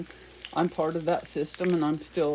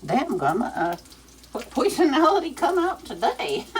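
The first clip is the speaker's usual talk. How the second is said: teasing grandma voice; 8 semitones higher, 6 dB louder than usual. Pretend. Voicing grandma teasing herself.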